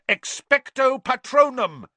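high, loud, drawn-out spell cry; roar. A narrator's reading. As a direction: roar out the incantation slowly. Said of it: "whipped out his wand and roared".

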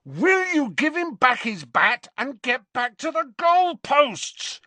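loud angry roar across the pitch. Acted. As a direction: bellow it at him. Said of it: "roared Harry".

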